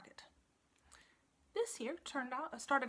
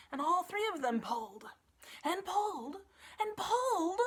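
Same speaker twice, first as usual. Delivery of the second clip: building strain; 9 semitones higher, 6 dB louder than usual. A narrator's reading. Narrator building the pulling, climbing higher. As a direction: pitch climbing, louder each pull.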